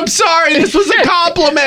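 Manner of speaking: high-pitched shouted apology; pretend yell. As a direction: holler an apology across traffic. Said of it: "I yell all my compliments".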